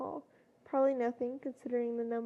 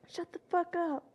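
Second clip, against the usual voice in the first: higher-pitched, mild angry yell; yelling back; 4 semitones higher than usual. A narrator's reading. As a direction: fire back angrily without shouting hard. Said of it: "Bakugo yelled back unthinkingly".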